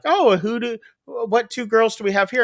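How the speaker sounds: high, sing-song teacher greeting; sweet teacher. Retold or imitated. Teacher welcoming the new girls, sing-song. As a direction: coo it like a friendly schoolteacher.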